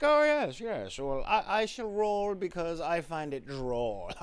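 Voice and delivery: high, lofty snooty elf; hoity-toity elf voice. Acted. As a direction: play a smug, superior elf. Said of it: "So I'm like".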